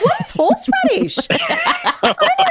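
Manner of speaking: high-pitched, incredulous squeal of confusion; mock bafflement. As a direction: act out comic bewilderment, voice shooting way up. Acts out her bafflement at show.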